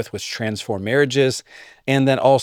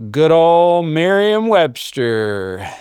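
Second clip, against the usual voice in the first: fond drawn-out mention; 5 semitones higher, 5 dB louder, much slower than usual. Real, not acted.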